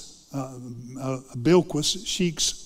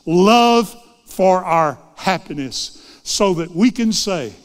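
slow, swelling preacher's cadence, louder each line; preaching at full force; 2 semitones higher, 6 dB louder, slower than usual. Acted. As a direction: build it like a revival list, drawing each phrase out. Building sermon list, preaching hard.

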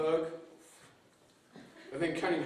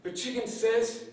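ordinary delivery, nothing marked

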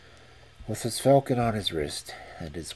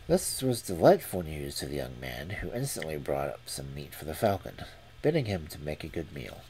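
ordinary delivery, nothing marked

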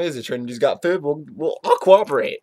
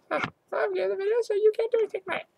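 high, rushed little voice; insulted squeaky character; faster than usual. Pretend. Puppy character taking over the video, squeaky rushed voice. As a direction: squeak it out fast and defiant.

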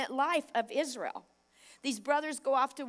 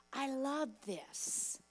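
ordinary talk

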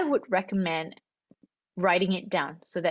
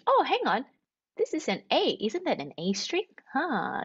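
higher-pitched mock discovery, swooping intonation; acted-out realization; 6 semitones higher than usual. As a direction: play a teacher prompting a pupil's discovery. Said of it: "then you have to distinguish".

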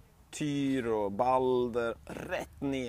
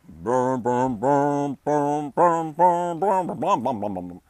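building louder brum then fading; marching drone; 2 semitones higher, 7 dB louder, much slower than usual. Acted. Soldiers marching off to war, droning brum. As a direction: chant the march, swell then fade.

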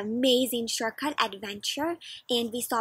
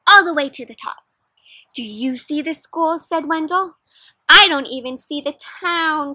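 lively storybook read-aloud for a class; reading to children; 3 semitones higher, 8 dB louder than usual. Acted. Teacher reading a picture book aloud to her class, lively. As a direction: paint the story for the kids.

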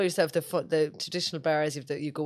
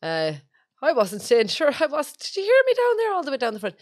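rising into a high, loud rural farmer voice; imitating her dad; 7 semitones higher, 5 dB louder than usual. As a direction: do the old Irish farmer, getting louder. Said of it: "he was like".

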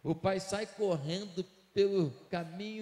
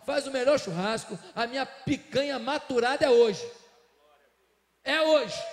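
louder, exultant commands; joyful father's orders; 3 semitones higher, 8 dB louder than usual. Acted. Father ordering servants to celebrate, exultant and loud. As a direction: throw a party in the voice.

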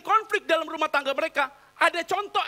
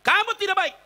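mock angry husband; 6 semitones higher, 5 dB louder than usual. Husband scolding, pointing.

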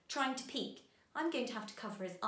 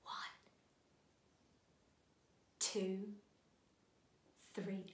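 ordinary talk, nothing marked

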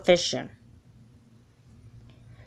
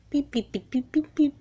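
phone ring imitation; 6 semitones higher, 6 dB quieter than usual.

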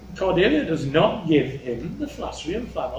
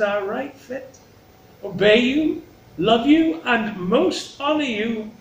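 high, measured, theatrical plea; Cordelia, spelling it out; 6 semitones higher, slower than usual. Acted. Cordelia really spells it out to her father, measured. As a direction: set out each duty distinctly, pitched high.

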